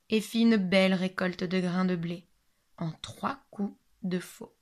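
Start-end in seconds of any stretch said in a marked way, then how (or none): 2.8-4.5 slow whisper; slow hushed telling. A narrator's reading. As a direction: whisper it slowly. Whispered narration.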